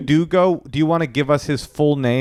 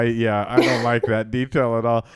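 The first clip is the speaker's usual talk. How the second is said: ordinary delivery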